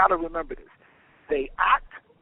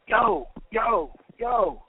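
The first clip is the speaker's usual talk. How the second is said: higher-pitched, excited "yo, yo, yo"; eager, high-pitched hail to a pal; excited call-out; 11 semitones higher, much slower than usual. A narrator's reading. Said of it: "he calls out to you excited".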